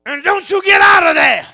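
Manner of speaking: high, loud scolding shout; imitated angry wife. Wife scolds toddler in the playpen, imitated angry shout. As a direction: shout the order as an angry mother. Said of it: "angrily says to my daughter".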